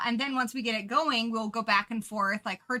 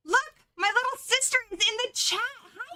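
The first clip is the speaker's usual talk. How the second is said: excited; 10 semitones higher than usual. Real, not acted. Spots her sister in the chat.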